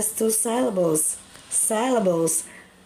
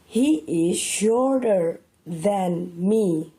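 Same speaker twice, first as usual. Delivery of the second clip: He is shorter than me said very slowly; slow model sentence; much slower than usual. Shown as a demonstration. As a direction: spell it out slowly for learners. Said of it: "For example".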